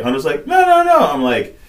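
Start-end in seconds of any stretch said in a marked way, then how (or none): 0.5-1.0 high, loud imitated objection; mock protest. Retelling Hunter rejecting the turn toward Mexico, high mock protest. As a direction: squeal out a hurried mock refusal.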